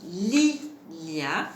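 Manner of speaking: "Lilia" in two separated beats, first one held; syllabes détachées. Shown as a demonstration. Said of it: "la syllabe li est au début". (« Lilia » en deux syllabes bien séparées)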